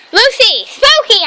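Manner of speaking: high, loud call. Real, not acted. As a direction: shout the names. Calling dogs.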